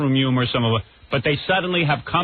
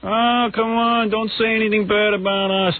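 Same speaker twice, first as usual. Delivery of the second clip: mock whining; 6 semitones higher than usual. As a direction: whine it out, stretching the opening words. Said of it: "they all start whining".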